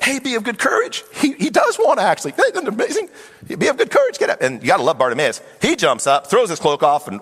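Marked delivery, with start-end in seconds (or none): 0.0-4.4 high-pitched, thrilled encouragement; excited urging. Acted. Crowd urging blind Bartimaeus to get up, excited and high. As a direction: cheer him on excitedly, voice way up.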